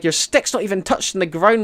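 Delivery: mimicked shouted remark; imitated heckle. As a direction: call it out like the man in the video. Quoting man in another video.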